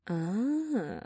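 ordinary delivery

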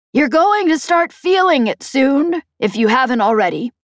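Peg warning. Acted. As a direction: press the warning home as Peg. Peg's character voice in narration.

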